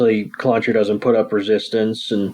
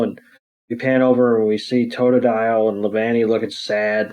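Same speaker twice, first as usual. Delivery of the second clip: ordinary talk, nothing marked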